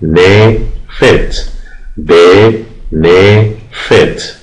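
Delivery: بنفیت split slowly into three separate syllables. Shown as a demonstration. Teacher counting syllables for the class, بنفیت drawn out in three parts. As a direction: say بنفیت very slowly, a gap after each syllable. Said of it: "سه سیلاب داره".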